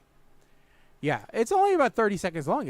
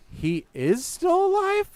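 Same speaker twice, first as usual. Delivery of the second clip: high, incredulous question; can't believe he's still alive; shocked disbelief; 14 semitones higher than usual. Retold or imitated. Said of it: "and I'm like".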